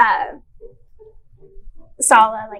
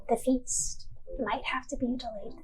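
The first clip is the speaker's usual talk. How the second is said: hushed foreboding; 10 dB quieter than usual. Acted. Torin gravely foretells delayed feast.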